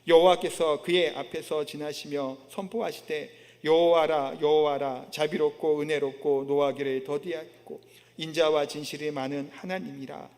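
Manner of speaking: low, level recited verse; scripture reading. Acted. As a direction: read the passage out solemnly in a low monotone. Reads scripture with congregation.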